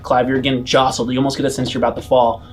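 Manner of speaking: usual speaking voice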